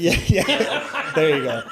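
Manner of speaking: bursting into high laughter; real laughter over a word mix-up; laughing. Real, not acted.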